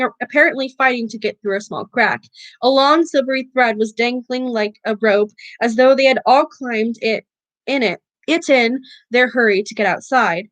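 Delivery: ordinary delivery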